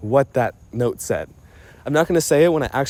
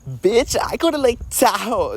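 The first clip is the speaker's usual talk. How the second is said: high, loud mocking voice; mock their boast in a raised, louder voice; mocking another person; 10 semitones higher, 4 dB louder than usual. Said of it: "this person was like".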